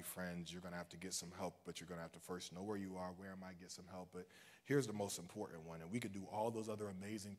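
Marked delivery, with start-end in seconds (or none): 3.2-4.1 quiet. Real, not acted. Soft repeat of the refrain.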